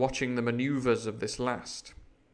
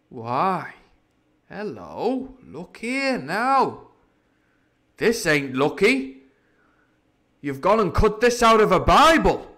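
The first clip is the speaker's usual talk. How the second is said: slow, louder pirate voice, growing alarmed; dismayed pirate; 6 semitones higher, 8 dB louder, slower than usual. Acted. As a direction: discover the torn Bible with dread.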